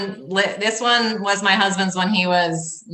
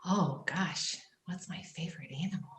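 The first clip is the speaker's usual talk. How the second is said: whisper; 3 semitones lower, 15 dB quieter than usual. Real, not acted. Repeating question to herself, thinking.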